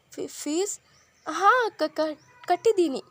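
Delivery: nervous stammer with a high, loud ha. A narrator's reading. Younger sister caught lying to elder sister, stammering. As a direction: caught out, stumbling over the words. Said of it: "ಎಂದಳು ತಡವರಿಸುತ್ತಲೇ".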